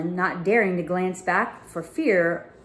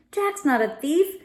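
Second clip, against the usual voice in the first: through clenched teeth; 9 semitones higher than usual. A narrator's reading. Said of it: "grunted between her clenched teeth".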